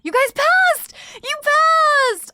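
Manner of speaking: high, loud celebratory shout; whooping congratulations; excited cheer. Real, not acted. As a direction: yell it out, thrilled for them. Celebrating viewers who passed.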